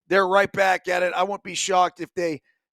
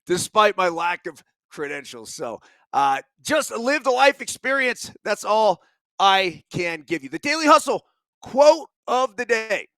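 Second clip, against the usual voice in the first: usual speaking voice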